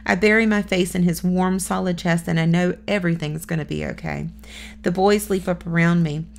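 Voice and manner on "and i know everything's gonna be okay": plain reading voice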